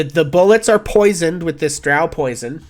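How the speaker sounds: ordinary talk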